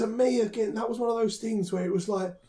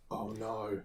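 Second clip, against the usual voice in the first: low, very soft sinking reaction; hushed dread; 7 semitones lower, 14 dB quieter than usual. Retold or imitated. Viewer recalls first seeing her hulk out, hushed dread. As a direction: drop right down, as if dreading what comes. Retells own reaction while watching.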